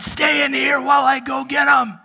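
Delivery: high, strained action-movie shout; hammed-up urgent yell; mock heroic order. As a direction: command her like a panicked action hero. Acting heroic sleepwalker ordering his wife.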